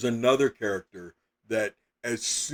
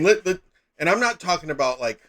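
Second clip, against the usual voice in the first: ordinary talk, nothing marked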